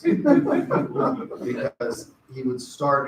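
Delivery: ordinary talk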